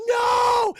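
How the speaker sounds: long, high, loud mock wail; horrified scream. Imitating friends watching Chaz get impaled, drawn-out despairing scream. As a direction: cry out in hammed-up despair. Acting out horror at Chaz's death.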